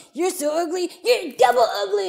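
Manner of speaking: put-on high, loud childish insult; gleeful playground mockery; taunting boy. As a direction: jeer at the fish. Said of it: "He goes".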